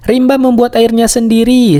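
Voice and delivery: high-pitched, lightly acted delight; storyteller's gently delighted cry; happy exclamation. A narrator's reading. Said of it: "seru Lili senang".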